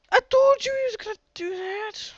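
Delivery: dog's voice. Retold or imitated. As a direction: squeak it out as the smug dog. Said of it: "When the dog be like".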